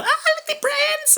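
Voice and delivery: high mock-swooning gush; swooning girl. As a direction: swoon like a girl seeing her idol. Girls swooning over the prince.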